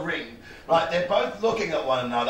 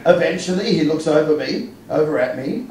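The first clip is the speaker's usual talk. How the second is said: normal talking voice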